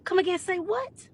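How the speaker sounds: mock outrage. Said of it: "I said".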